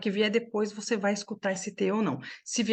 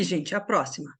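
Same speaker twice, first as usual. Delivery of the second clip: ordinary talk, nothing marked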